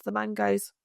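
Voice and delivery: normal talking voice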